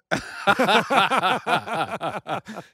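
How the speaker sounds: long, loud burst of laughter; big cackling laugh. Real, not acted. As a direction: crack up completely. Laughing at the Montana joke.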